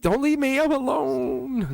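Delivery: high, drawn-out whine on the last word; mock abandoned plea. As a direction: wail it pleadingly, hammed up. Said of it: "that's a sign of us being unhealed".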